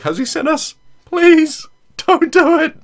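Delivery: panicked squeaky pleading; scared high squeal. Retold or imitated. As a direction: squeal it in terror. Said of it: "I was just like".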